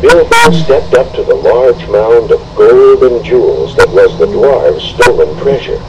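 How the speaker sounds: ordinary delivery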